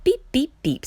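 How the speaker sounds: cheerful mock horn beeps; truck horn beeps. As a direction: toot it like a friendly little horn. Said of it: "said Little Blue".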